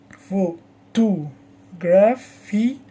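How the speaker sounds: pho-TO-graph-y, very slow, syllable by syllable; slow teaching demo of pho-to-graph-y, stress moved to to; shifted word stress. Shown as a demonstration. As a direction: break pho-to-graph-y into slow beats, leaning on to. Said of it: "the stress moves because the word is longer".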